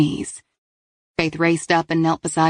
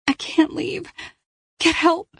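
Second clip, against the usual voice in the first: high, strained plea in labor; pained young woman; 12 semitones higher, slower than usual. Acted. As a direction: push the words through pain. Paige in labor pleads.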